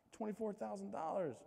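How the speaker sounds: high, hushed disbelief at the sum; stunned, breathless surprise; astonished. Retold or imitated. Shocked by bonus.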